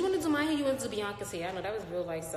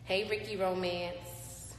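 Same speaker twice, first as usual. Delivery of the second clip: ordinary talk, nothing marked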